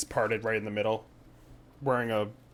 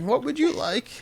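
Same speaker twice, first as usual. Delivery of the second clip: put-on kid voice; 11 semitones higher than usual. Kid taking their order.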